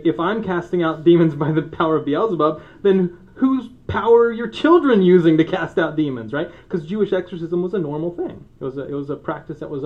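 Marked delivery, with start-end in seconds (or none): 0.0-6.3 loud, high rhetorical challenge; challenging Jesus. Retold or imitated. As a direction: throw the question back at them. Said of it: "he's gonna say".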